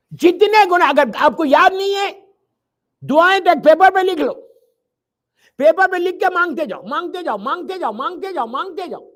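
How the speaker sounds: loud, high, rising sermon cry; forceful preaching. Acted. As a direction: preach it out with full force to the crowd.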